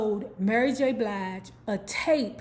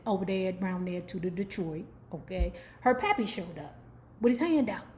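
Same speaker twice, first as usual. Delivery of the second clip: usual speaking voice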